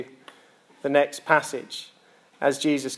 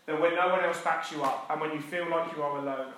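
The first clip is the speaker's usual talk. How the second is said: ordinary delivery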